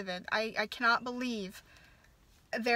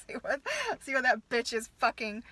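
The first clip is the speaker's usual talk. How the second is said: ordinary talk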